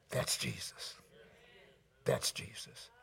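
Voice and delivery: near-whispered, low affirmation; reverent hush. Real, not acted. Pastor telling the congregation who this is, hushed. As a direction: drop right down to a hush. Hushed climax after the names.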